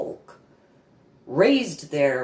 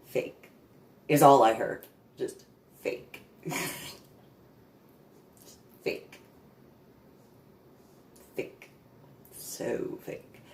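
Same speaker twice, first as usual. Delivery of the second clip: hushed, breathy repetition of fake; soft mocking whisper; 2 semitones lower, 4 dB quieter than usual. Real, not acted. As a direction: whisper it to yourself. Inner voice repeating fake.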